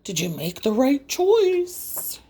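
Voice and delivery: an eerie clock voice asking; lightly voiced spooky clock; talking grandfather clock. A narrator's reading. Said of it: "The grandfather clock said".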